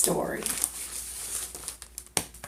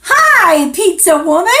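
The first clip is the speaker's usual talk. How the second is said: much higher, louder hello; excited call; 9 semitones higher, 12 dB louder than usual. Acted. To the child, greeting the pizza woman, excited.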